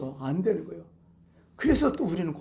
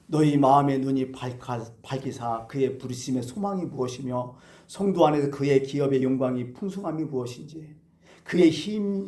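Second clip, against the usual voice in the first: steady, lower solemn reading voice; scripture reading; 6 semitones lower than usual. Acted. Preacher reading Paul's letter to the congregation, solemn scripture reading. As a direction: read the apostle's prayer with reverence.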